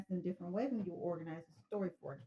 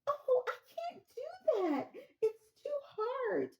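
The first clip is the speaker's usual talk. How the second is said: much higher, slow, half laughing half sobbing; hysterical caller; 13 semitones higher, 4 dB louder, slower than usual. Acted. Caller to the operator, high and laugh-sobbing in mock distress. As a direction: fall apart on the phone, laughing through mock tears.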